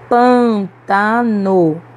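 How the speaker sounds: "pântano" very slow, in three syllables, strong pân; stress on antepenultimate syllable. Shown as a demonstration. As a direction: stretch out "pântano", leaning on the first syllable. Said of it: "que nós pronunciamos com mais força". ("pântano" bem devagar, silabado, com força em pân)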